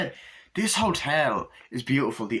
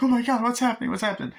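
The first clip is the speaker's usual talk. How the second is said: high, loud frightened cry; imitating a woman fleeing in panic; panicked gasp; 12 semitones higher, 3 dB louder, much faster than usual. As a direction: blurt it out running scared. Wendy running up the stairs, panicked.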